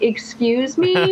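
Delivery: slow, drawn-out disbelieving excuse me; stunned excuse me. Retold or imitated. To the guy who just broke up: slow stunned disbelief. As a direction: stretch it out like you can't believe your ears.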